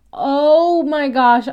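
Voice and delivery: excited. Real, not acted. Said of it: "like, freaking out".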